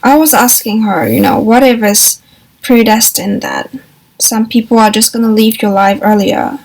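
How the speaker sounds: ordinary talk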